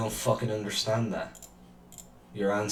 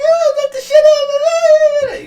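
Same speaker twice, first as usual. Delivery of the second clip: high, loud squealing mockery of her cheer; hammed-up imitation of a gleeful woman; mock jolly woman; 11 dB louder than usual. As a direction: screech her glee back at her, much higher and louder. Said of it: "she's so jolly about it".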